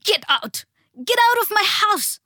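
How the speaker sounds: high, loud shriek building in force. A narrator's reading. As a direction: scream them out of the house. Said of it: "her mom shrieked".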